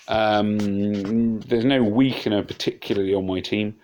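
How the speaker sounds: ordinary talk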